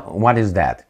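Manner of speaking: ordinary delivery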